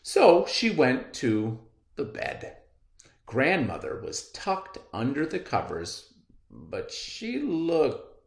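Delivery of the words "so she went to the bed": ordinary delivery, nothing marked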